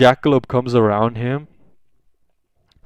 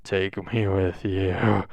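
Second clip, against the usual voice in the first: low, soft, drawn-out groan of words; weary plea; 4 semitones lower, 7 dB quieter, slower than usual. Acted. Teacher begging escaping students to take him, wearily. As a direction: drag it out slowly, quietly fed up.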